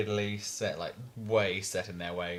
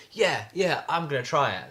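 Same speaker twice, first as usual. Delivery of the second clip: higher, louder mock enthusiasm; acted eagerness; 6 semitones higher, 5 dB louder than usual. Keen person taking on Dry January, acted eagerness. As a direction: play someone keenly signing up.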